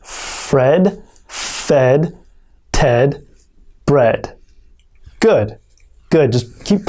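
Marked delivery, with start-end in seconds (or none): 0.0-4.4 Fred fed Ted bread spoken slowly as a model; model for repeating. Shown as a demonstration. Teacher modelling the tongue twister to repeat. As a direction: say it clearly for the class to copy. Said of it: "Okay, try that".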